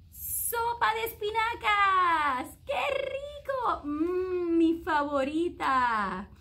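high loud slow squeal of joy; squealing delight. A narrator's reading. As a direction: squeal over a favorite treat. Child delighted by spinach soup.

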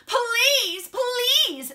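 high, loud, stretched-out begging; pleading. Acted. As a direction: beg mom desperately, dragging each word. Andrew begging his mom.

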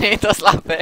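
laughing. Real, not acted. Laughing during the round.

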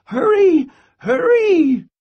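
very loud, high urgent cry; urgent call. A narrator's reading. Grandmother shouts for squirrel to hurry, very loud and high. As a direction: shout it to hurry him home. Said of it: "she called".

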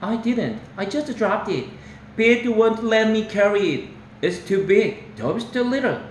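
put-on high young boy's protest; little boy. Toby pleading with his brother, high childlike voice. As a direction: plead like a small kid. Small boy defends himself.

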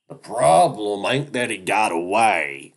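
high, loud, slow screech; shrill angry weasel voice. Acted. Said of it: "Duke is practically screeching".

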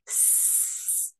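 S as a long voiceless hiss; drawn-out s like a snake. Shown as a demonstration. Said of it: "Letter S sounds like a snake".

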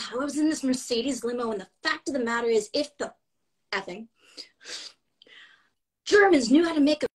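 put-on a light New York accent as Joan Rivers, exasperated rant. The speaker retells Joan's excuse to Jimmy, in a light Joan Rivers accent. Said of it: "with the accent and everything".

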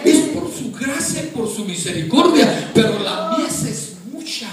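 loud, high, impassioned pulpit delivery; full-force preaching. Real, not acted. Preacher urging the congregation to serve God, impassioned preaching. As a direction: build to a drawn-out, crying-out climax.

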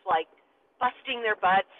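ordinary delivery

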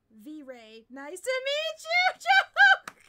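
squealing the greeting, high and slow; hammed-up high shout. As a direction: overblown joke greeting with laughs. Joke greeting, shouted and laughing.

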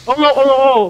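high, loud cries of panic; panicked. Real, not acted. Player suddenly attacked in game, panicked. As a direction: cry out in sudden alarm.

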